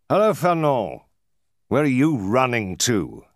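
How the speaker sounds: high-pitched calling out; exclaimed hail. A narrator's reading. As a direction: hail someone hurrying past. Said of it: "exclaimed a voice".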